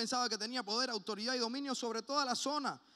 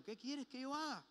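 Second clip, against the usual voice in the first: imitando sumisión, más agudo y suave; temeroso, sumiso; 5 semitones higher, 6 dB quieter than usual. Acted. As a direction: pregunta con humildad y temor, en voz baja. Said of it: "temblando y temeroso, le dijo". (súplica suave y temerosa)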